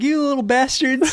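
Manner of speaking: high, put-on old-lady yell; old lady scolding. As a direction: shriek it at the kids from the window. Old lady yells at kids.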